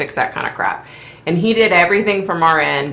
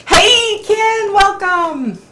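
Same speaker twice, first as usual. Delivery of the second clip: much higher and louder "Hey, Ken, welcome"; excited greeting; 5 dB louder, much slower than usual. Real, not acted. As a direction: call out a bright, delighted welcome. Greeting viewer arriving live.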